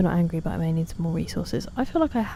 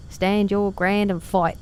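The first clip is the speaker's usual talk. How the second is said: mock bad Geordie-tinged Cockney, slow; imitating a clumsy Cockney line delivery, drawn out; hammed-up failed Cockney accent; 3 semitones higher, 3 dB louder, slower than usual. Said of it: "that line is particularly bad".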